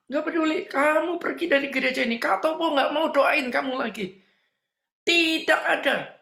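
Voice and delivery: high, emphatic dismissal; retold angry refusal. Preacher sends girl out of the church, high stern refusal.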